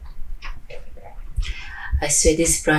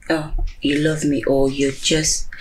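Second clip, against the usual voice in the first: ordinary talk, nothing marked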